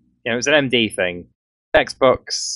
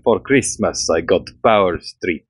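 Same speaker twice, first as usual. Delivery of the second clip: imitated thick Finnish accent, sinking lower; 6 semitones lower, slower than usual. Retelling a friend's power strip video, thick Finnish accent. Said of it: "he said in that thick Finnish accent".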